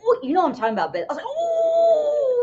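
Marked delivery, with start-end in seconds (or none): 1.3-2.4 very high, drawn-out gasp of amazement; mock stunned reaction. Moviegoer recalling the big twist, exaggerated astonishment. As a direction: stretch it out way up high, imitating shock.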